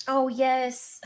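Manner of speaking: high, put-on gushing voice; mock effeminate man. Mocking men thrilled by their chests, high delighted coo.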